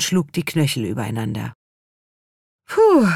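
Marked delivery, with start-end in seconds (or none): 2.7-3.1 high, loud exhaled puh of relief; sighing puh. A narrator's reading. Henry sits down after the dance, loud relieved sigh. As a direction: let out a big puffing sigh after the dancing. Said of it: "seufzte er".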